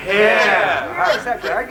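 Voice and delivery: usual speaking voice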